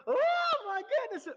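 high, loud, slow fake lament; mock wailing mourner. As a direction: ham up a professional mourner's wail. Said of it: "So they went from".

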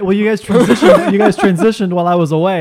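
loud laughing complaint. Real, not acted. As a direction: laugh while teasing them. Returns with bear, laughing.

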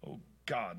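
soft, low breathy plea under the breath; hushed prayer. Acted. Wife praying it's not a mistake, hushed plea. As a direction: pray it quietly to yourself. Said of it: "Lori whispered".

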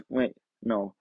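usual speaking voice

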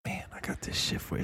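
furtive back-alley whisper; shady dealer whisper. Acted. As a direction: whisper it like a dealer offering contraband. Plays dealer in dark alley.

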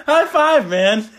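high, loud celebratory cheer; mock congratulation. Co-host echoing the imagined dad, mock congratulation. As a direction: cheer him on like a goofy bro.

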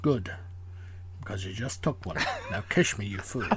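a hint of Connery, dropping low to the end; Sean Connery impression. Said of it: "Sean Connery accent".

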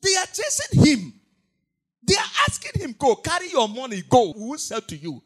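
very high loud excited telling; loud preaching. Real, not acted. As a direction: shout the scene at the crowd. Preacher acting the chasing women.

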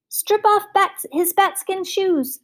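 high, drawn-out shouted order; shouting. A narrator's reading. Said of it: "shouted the will-o'-the-wisp".

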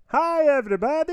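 very high, loud cartoonish greeting; mystery character impression. Host posing a guess-the-impression riddle, loud high-pitched character voice. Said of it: "That was a terrible SpongeBob impression".

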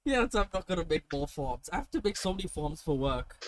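ordinary talk

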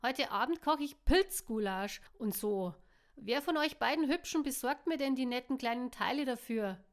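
loud call from the kitchen to both friends. A narrator's reading. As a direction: call it through the kitchen door. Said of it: "rief Ira durch die Küchentür".

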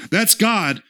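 normal talking voice

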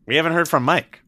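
ribbing him for letting me hog the mic; teasing call-out. Real, not acted. Teasing host calls out quiet Mike.

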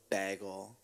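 "bagel" with a short a, as in bag; mocked pronunciation. Friend echoing her word back, "bagel" said BAG-ul. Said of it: "Who says bagel?".

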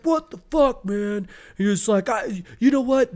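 high-pitched mock outrage; exasperated protest. Acting out being cut off in traffic, high exasperated protest. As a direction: protest in strained, hammed-up disbelief. Cursing at a driver.

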